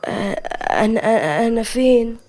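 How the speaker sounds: groggy, halting, just waking up; woozy, confused waking voice; sleepy and dizzy. Acted. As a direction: stumble over the words as you come round. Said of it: "مليكة بنوم ودوخة".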